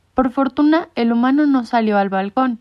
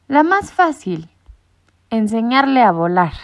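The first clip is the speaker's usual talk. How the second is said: ironic; 3 semitones higher, 5 dB louder than usual. A narrator's reading. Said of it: "maulló Sorbas con ironía".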